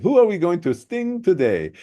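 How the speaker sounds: a hint of the warlord's African accent; imitated African warlord accent.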